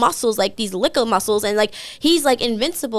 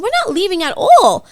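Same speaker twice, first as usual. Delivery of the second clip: high, raised-voice scolding; retold indignation; 9 semitones higher, 6 dB louder than usual. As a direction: lay down the law to a drunk date. Retells her angry confrontation.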